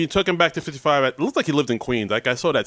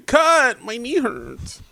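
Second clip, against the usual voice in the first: high pained whine; whiny hurt voice; 8 semitones higher, much slower than usual. Pretend. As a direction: act babyish over a sore knee. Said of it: "that's how I sound when I'm hurt".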